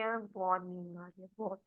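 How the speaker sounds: low, soft, sinking sleepy voice; drowsy demo. Shown as a demonstration. Coach showing how sleepy it should feel, soft. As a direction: let the voice sink as if drifting off. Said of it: "पूरी feeling आनी चाहिए".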